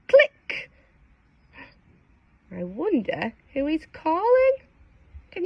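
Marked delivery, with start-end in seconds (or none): 0.1-0.7 click sound. Acted. Phone button sound.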